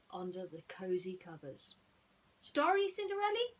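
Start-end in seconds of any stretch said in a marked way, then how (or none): 2.4-3.5 much higher, louder mouse plea; mouse begging. Acted. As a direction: beg for a story like an excited mouse. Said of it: "someone cried".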